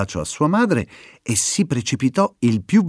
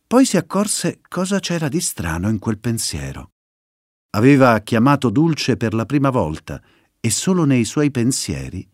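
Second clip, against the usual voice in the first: ordinary delivery, nothing marked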